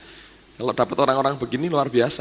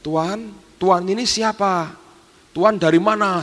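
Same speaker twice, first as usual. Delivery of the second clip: higher, slow, timid questions to a stranger; mock-fearful asking, pitched up and slowed; imitating a frightened maid; 6 semitones higher, slower than usual. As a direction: act out her fear as she asks who he is. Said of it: "Sambil takut dia bilang".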